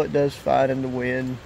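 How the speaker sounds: ordinary talk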